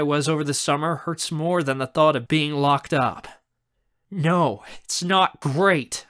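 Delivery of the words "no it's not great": raised, slow, emphatic retort; angry. Acted. Raz rejects uncle's relief, angry. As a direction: throw it back, drawn out and indignant. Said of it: "I say angrily".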